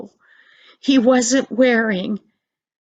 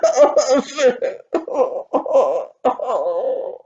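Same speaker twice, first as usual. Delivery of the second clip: sob. Acted. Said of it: "And he started to cry".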